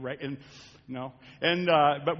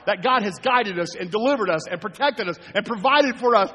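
loud, high, fervent preaching; rally the congregation with full preaching force; pulpit-pounding sermon build; 5 semitones higher, 8 dB louder than usual. Acted.

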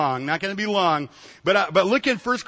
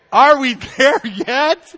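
put-on drawn-out, louder kid's whine with a laugh; whiny little brother; 6 semitones higher, 9 dB louder, slower than usual. Little brother nagging dad on the road trip, loud slow whine. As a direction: play the impatient little brother in the van. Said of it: "your little brother says".

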